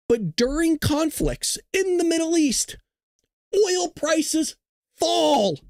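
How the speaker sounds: high, slow, wide-eyed wonder; mock amazement. Real, not acted. Marvelling at falling oil prices.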